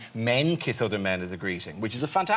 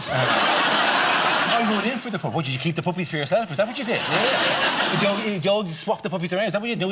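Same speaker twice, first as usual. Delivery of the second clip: put-on high, fast, indignant kid; whiny kid voice; 8 semitones higher, 4 dB louder than usual. Kids complaining about puppies.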